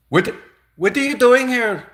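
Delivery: mock disbelief at the applicant; outraged interviewer. Interviewer demanding why the applicant came, high loud mock outrage.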